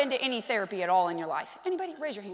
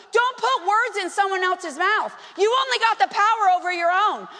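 ordinary talk

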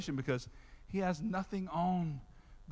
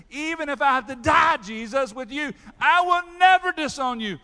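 loud, higher, insistent promise; loud vow; 10 semitones higher, 11 dB louder than usual. Retold or imitated. Peter vowing to Jesus he will never disown him, loud and high. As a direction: swear it like you mean it.

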